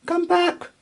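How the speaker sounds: very high call after the berries; crying out. A narrator's reading. Mouse calls out high to the rolling berries. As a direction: call after them as she runs. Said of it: "cried Milly".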